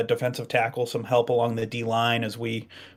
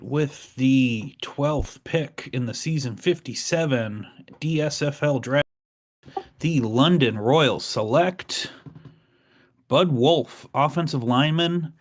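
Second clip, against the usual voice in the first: announcer read; 3 semitones higher, slower than usual. Acted. Formal draft pick announcement.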